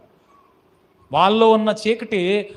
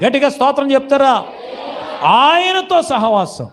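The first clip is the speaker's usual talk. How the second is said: fervent preaching; 6 dB louder than usual. Acted. Preacher calling the congregation to praise loudly, fervent. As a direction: stretch the key words, rousing the congregation.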